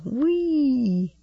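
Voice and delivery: high, delighted cry of glee; gleeful whoop. A narrator's reading. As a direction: whoop it like a joyriding grandpa. Grandfather joyriding in car.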